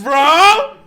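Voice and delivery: loud high shocked bro; shout. Real, not acted. Reacting to the steamy excerpt, real shocked shout. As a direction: blurt it out scandalised.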